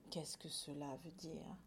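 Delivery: low, soft puzzled murmur; hushed suspicion. Acted. Farmer puzzled by the spared field, hushed suspicion. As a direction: wonder under your breath.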